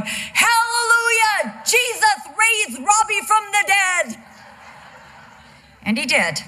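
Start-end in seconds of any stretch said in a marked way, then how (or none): 0.0-4.2 put-on much higher, slow, jubilant shout of a little boy; boy running down the hall announcing good news, ecstatic; joyful child cry. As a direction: proclaim a miracle like a thrilled four-year-old.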